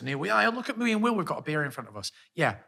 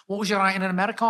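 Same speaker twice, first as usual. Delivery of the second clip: raised-pitch northern English mimicry; mock northern accent; 5 semitones higher, faster than usual. Teasing a co-host over his question, mock northern accent. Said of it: "I've never heard you be more northern".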